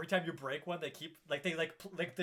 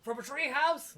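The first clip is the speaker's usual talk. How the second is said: mocking; 10 semitones higher, 5 dB louder than usual. Mocking the bluff.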